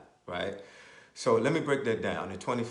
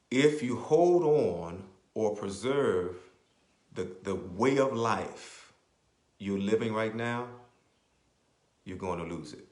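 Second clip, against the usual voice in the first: slow and deliberate, word by word; said slowly; 3 dB louder, slower than usual. Shown as a demonstration. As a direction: lay it out slowly so they get it. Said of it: "I'm gonna say it slowly because I'm educating you".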